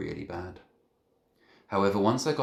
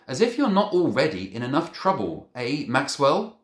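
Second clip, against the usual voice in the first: sarcastic scolding; 8 semitones higher, 8 dB louder than usual. A narrator's reading. Head scolds boy sarcastically.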